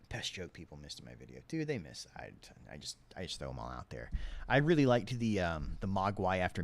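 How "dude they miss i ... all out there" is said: ordinary talk, nothing marked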